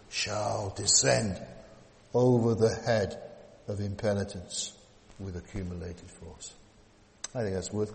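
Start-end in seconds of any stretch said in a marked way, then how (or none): 0.1-6.5 slow build, dropping to a whisper then rising; dramatic sermon climax. Acted. Preacher warning the congregation of judgment, dramatic build.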